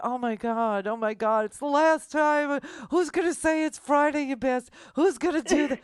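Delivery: upset cry of someone almost in tears, loud and high; imitating Brett. Host retelling how Brett was almost in tears, mock distraught shout. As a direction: overwrought wail, hammed up. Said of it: "he was like".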